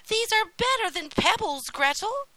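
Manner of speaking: put-on high, loud, gleeful boy; merry. A narrator's reading. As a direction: tell your sister with high-pitched glee. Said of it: "said Hansel merrily".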